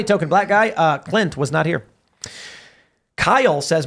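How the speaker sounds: ordinary talk, nothing marked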